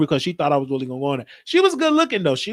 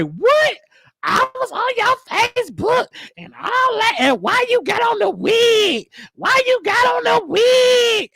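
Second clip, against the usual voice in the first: much higher shouting lady voice, slowed on the wig line; mock outraged shout; slower than usual. Coworker voiced, shouting accusations.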